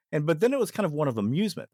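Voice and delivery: normal talking voice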